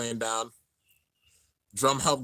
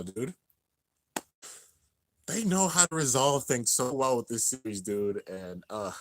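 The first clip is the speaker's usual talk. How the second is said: ordinary talk, nothing marked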